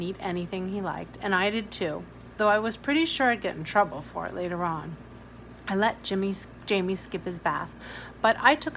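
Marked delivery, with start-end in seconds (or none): none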